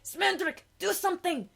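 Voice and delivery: high, loud urgent cry; Molly screaming at Smendrick for help; panicked plea. Retold or imitated.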